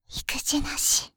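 slow sulky whisper. Acted. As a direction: pout it out in a whisper. (すねたささやき)

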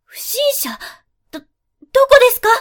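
high, loud startled cry; alarmed surprise. Acted. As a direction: jump at the word intruder. Hears of an intruder, alarmed.

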